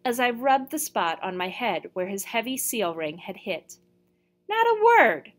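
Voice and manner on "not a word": very high, loud insistence; shrill aggrieved outburst; indignant protest. A narrator's reading. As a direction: swear you said nothing.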